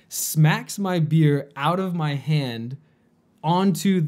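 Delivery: normal talking voice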